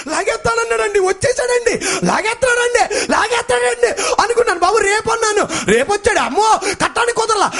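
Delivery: panicked cry. Retold or imitated. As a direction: yell it like the power is about to be cut. Panic over power bill cutoff.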